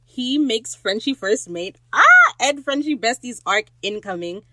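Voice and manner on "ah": very high, loud fan squeal; piercing burst of fangirl excitement; excited squeal. Real, not acted. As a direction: shriek with delight at the news. Fan squealing over plot twist.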